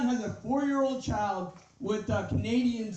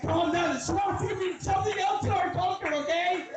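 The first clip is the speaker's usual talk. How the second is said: high laughing shout; laughing mock shout; 8 semitones higher than usual.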